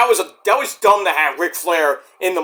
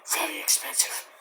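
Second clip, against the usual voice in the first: whispered aside; 12 dB quieter than usual. Real, not acted. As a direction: drop into a whisper. Aside on dreaded ticket price.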